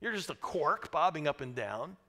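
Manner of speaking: normal talking voice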